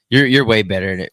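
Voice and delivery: ordinary delivery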